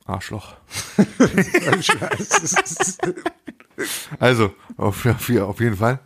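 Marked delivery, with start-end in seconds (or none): 0.7-3.5 long, high laughing fit; laughter. Real, not acted. Friend laughing after teasing his co-host, long high laughter. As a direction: burst into high, sustained laughter.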